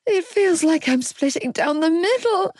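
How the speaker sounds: strained high woman's voice in labour pain. A narrator's reading. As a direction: speak through the pain, high and tight. Labour pain, narrator voicing Aoife.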